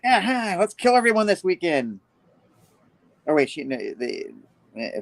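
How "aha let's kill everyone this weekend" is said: high, cheery murderous glee; gleeful villain. Retold or imitated. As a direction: announce mayhem like a treat.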